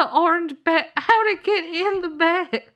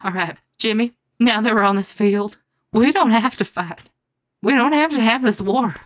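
much lower, earnest hero voice; deep grave voice; 5 semitones lower than usual. Acted. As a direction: plead low and solemn. Grave plea before duel.

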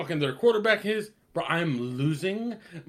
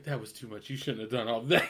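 disapproving wife; 6 semitones lower, 6 dB quieter than usual. Acted. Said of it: "the wolf man's wife is like".

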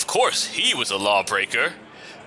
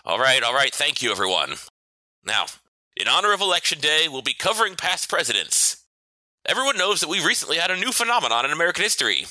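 teacher voice. Acted. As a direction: settle the class down sternly. Teacher addressing class.

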